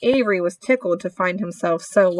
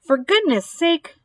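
high-pitched exasperation, no real volume; mild mock bellow; 7 semitones higher than usual. A narrator's reading. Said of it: "bellowed Mister Zuckerman".

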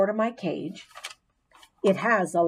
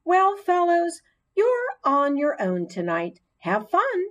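bright, higher teacher goodbye; cheerful teacher voice; 8 semitones higher than usual. Acted. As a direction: sing it out like a breezy teacher. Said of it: "Missus Brisbane gathered ... and said".

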